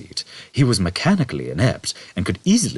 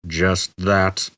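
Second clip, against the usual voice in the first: contempt. A narrator's reading. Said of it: "convey his utter contempt".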